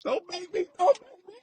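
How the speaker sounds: high, squeaky choked-up plea; about to tear up. Retold or imitated. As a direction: squeal it like tears are coming. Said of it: "I was just like".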